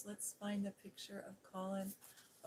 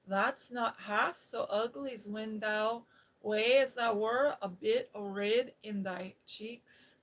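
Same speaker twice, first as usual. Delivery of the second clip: Yorkshire brogue; 9 dB louder than usual. Acted. Martha to Mary, in a broad Yorkshire brogue. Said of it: "She's speaking in that old Yorkshire brogue".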